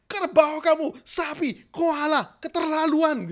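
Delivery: loud, high angry name-calling; angry insults. Shown as a demonstration. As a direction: hurl the insults with a tense body. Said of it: "Tapi kalau begini".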